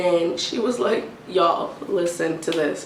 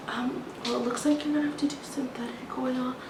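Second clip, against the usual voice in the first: unvoiced whisper, a little higher; mock the clerk who won't speak up; breathy hushed imitation of the cashier; 6 semitones higher, 9 dB quieter than usual. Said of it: "why do you keep whispering".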